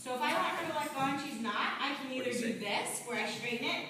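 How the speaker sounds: usual speaking voice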